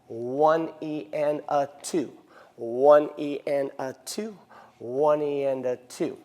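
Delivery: slow, even beat count. Shown as a demonstration. Dance teacher showing the count, slow and even. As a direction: count it out in rhythm. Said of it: "we counted this one E and a two".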